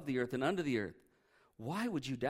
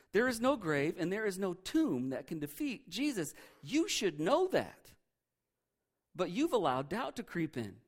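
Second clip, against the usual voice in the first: ordinary talk, nothing marked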